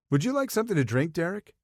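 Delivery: high, fast and flirty; a bright, quick flirt offering a drink. Acted. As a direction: coo it at him, eager to please. Sawyer flirting with Derek.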